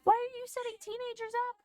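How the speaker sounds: high, strained disbelief; incredulous outburst. Retold or imitated. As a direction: squeak it out, baffled and fed up. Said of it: "And I was like".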